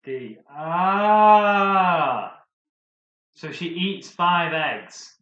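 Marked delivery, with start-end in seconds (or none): 0.5-2.4 high loud cry of realisation; excited shout. Real, not acted. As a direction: yell out the discovery. Realising the answer.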